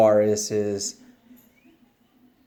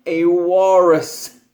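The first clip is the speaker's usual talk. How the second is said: slow, louder, drawn-out wish; dreamy pigeon wish; 6 semitones higher, 7 dB louder, slower than usual. Acted. Pigeon announces to the reader a slow new wish. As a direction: savour the new idea.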